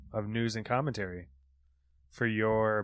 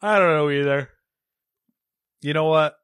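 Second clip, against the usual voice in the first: loud; 6 semitones higher, 12 dB louder than usual. Real, not acted. Loud burst after smite joke.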